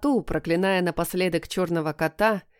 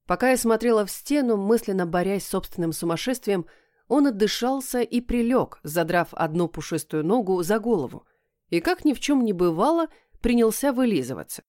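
ordinary delivery, nothing marked

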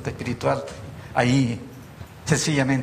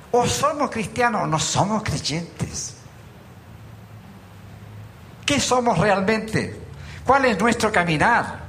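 fervent preaching; 5 semitones higher than usual. Acted. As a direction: press each point home to the flock. Preaching to the congregation.

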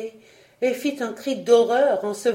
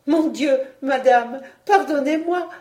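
higher, louder pleading voice; apologetic young girl; 5 semitones higher, 5 dB louder than usual. A narrator's reading. Marguerite begs the queen's pardon, high pleading. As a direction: beg pardon in a high girlish voice. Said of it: "elle fut prise d'un fou rire".

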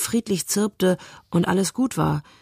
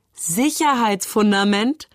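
higher, slow incredulous echo rising at the end; scornful amusement; 4 semitones higher than usual. A narrator's reading. Brother derisively echoing his sister's word, scornfully amused. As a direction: throw the word back as a mocking question. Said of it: "lachte Moritz".

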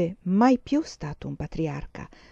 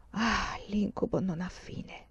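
slow, soft sigh of despair; inner thought; 9 dB quieter, slower than usual. A narrator's reading. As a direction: think it aloud, slow and hushed. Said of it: "pensò Talento Coraggioso". (pensiero sospirato, lento e sommesso)

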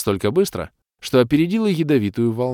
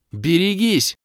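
high, loud warning cry; warning shout; 10 semitones higher, 4 dB louder, slower than usual. A narrator's reading. Kira warning the party of enemies, loud high shout. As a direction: shout the alarm. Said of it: "в голос крикнула Кира".

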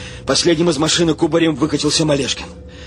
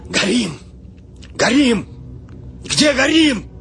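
high-pitched fire alarm, climbing; escalating alarm; alarmed cries; 9 semitones higher, slower than usual. A narrator's reading. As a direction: cry out in rising panic. Crew cries out that they burn.

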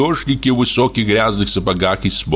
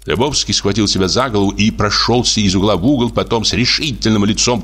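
usual speaking voice

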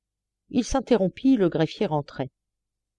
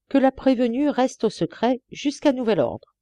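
firm order, higher; commanding; 7 semitones higher than usual. A narrator's reading. Judge orders the guard to keep her isolated, commanding. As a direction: issue the order with authority. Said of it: "commanda-t-il".

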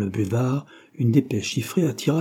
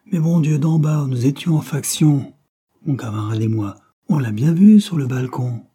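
louder and higher, arguing his case; heated insistence; 4 semitones higher, 6 dB louder than usual. Acted. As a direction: insist hard that you saw him on the balcon. Agent insists he saw anarchist.

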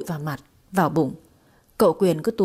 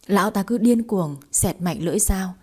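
usual speaking voice

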